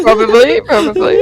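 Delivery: laughing through the words. Real, not acted. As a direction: giggle while admitting it. Laughing admission.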